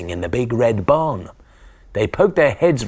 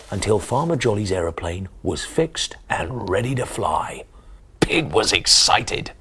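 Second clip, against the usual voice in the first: usual speaking voice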